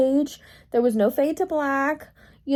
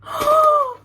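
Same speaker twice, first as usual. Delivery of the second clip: high, loud gasp of delight; sharp gasp; 6 dB louder than usual. Real, not acted. Vlogger opening a gift from a friend, gasping. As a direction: gasp at the surprise.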